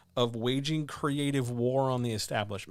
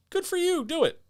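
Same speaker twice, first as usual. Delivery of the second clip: much higher, sing-song mock approval; sarcastic cheer; 4 dB louder than usual. Mocking writers who love extra numbers, high sarcastic cheer. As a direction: chirp it like fake encouragement.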